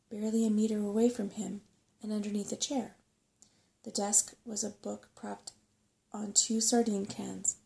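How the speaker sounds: plain reading voice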